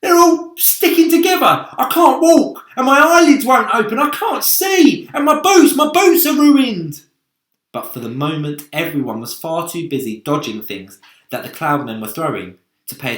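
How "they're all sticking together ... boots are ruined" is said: high, loud panicked complaint; centipede crying out. A narrator's reading. Centipede complains his legs and boots are stuck, high loud wailing. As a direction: wail over every ruined part of him. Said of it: "he cried".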